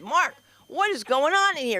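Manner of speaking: high alarmed question; startled wife calling out. Retold or imitated. Wife alarmed at wet spots.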